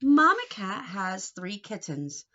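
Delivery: ordinary talk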